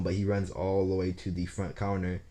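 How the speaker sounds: ordinary talk, nothing marked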